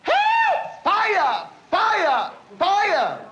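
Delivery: high, urgent alarm yells; demonstrated yell. Shown as a demonstration. Instructor teaches what to yell for neighbors, high urgent yelling. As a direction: yell each word as a separate high alarm.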